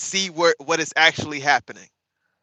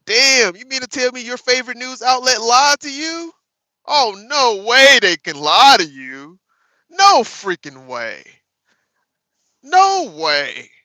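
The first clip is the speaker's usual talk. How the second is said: higher and louder mock shock; loud sarcastic disbelief; 8 semitones higher, 9 dB louder than usual. Streamer mocking viewers' favorite news outlet, loud sarcastic shock.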